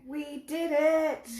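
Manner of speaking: high, slow triumphant cheer; drawn-out victory whoop; excited cheer. Real, not acted. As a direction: celebrate like you won. Celebrating getting the stream working.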